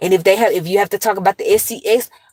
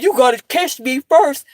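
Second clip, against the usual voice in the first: put-on higher cocky taunting kid; teasing nephew voice; 7 semitones higher than usual. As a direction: taunt her like a cheeky teen.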